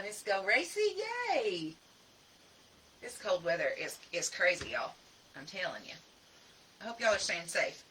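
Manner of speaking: ordinary talk, nothing marked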